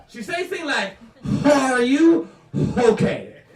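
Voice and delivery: put-on loud French Canadian accent with huge added Hs. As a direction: blast an exaggerated breathy H in French Canadian English. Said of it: "puts the H where there don't need to be the H".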